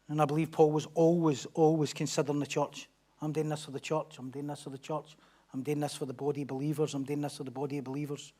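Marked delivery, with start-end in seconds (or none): 3.2-8.3 1 quiet inner voice. Retold or imitated. Voicing Paul's thought.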